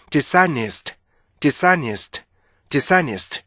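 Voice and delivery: "dishonest" run together as di-SA-nist; smoothly linked "dishonest", stress on the middle syllable; native-like linking. Shown as a demonstration. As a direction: say "dishonest" as one joined word, no break after dis. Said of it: "이렇게 읽어야 되는데".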